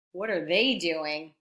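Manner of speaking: ordinary delivery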